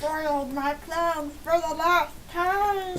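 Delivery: mock villain. Streamer playing the villain for a friend, slow mock villain voice. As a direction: declaim it as a thwarted bad guy. Villain line performed in jest.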